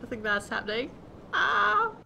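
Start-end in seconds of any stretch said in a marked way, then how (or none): 1.3-1.9 short high, loud "ah"; startled yelp. Real, not acted. Gamer as the scroll takes effect, startled yelp. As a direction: yelp out in sudden alarm.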